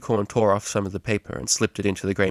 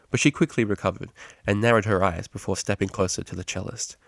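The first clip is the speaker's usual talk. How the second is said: plain reading voice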